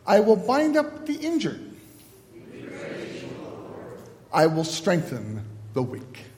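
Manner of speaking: measured, solemn liturgy voice with long pauses; liturgical leading. Acted. Pastor guiding the congregation through confession, measured and solemn.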